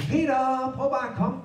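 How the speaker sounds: high, drawn-out beckoning call; calling over. A narrator's reading. Jesus invites Peter out onto the water, calling.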